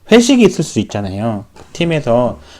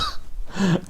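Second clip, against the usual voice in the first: short laugh; 7 semitones higher, 11 dB quieter than usual. Real, not acted. Laughs at his own relief.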